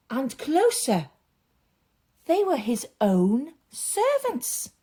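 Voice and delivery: lively, expressive storytelling voice; story narration for children. A narrator's reading. Storyteller reading a Bible story aloud to children, animated. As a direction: paint the story big for the kids.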